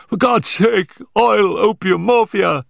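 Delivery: high, desperate pleading in pain; agonised cry. A narrator's reading. Injured man begs for painkillers, crying out in agony. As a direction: beg for relief in a high, strained cry. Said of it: "he cried".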